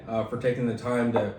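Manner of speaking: usual speaking voice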